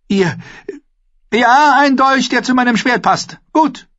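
high, loud royal approval; high-pitched keen agreement; eager boy king. A narrator's reading. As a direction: seize on the idea in a high voice.

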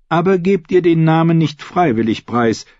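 high, brisk-spirited royal instruction; scheming queen. A narrator's reading. Queen briefing her lady-in-waiting, scheming. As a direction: dictate the message in a lifted voice.

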